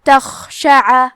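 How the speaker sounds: تخشع with a heavy breathy خ; تخشع with خ from the upper throat, with audible breath; slowly recited تخشع, heavy breathed خ; hams on kha. Shown as a demonstration. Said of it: "تو ہم یہ کلمہ کو ایسے پڑھیں گے".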